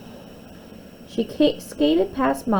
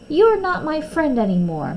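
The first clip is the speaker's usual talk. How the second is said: higher, louder hurt snap; a little girl breaking off the friendship; childish angry outburst; 9 semitones higher, 5 dB louder than usual. Acted. Quarrel between two girls.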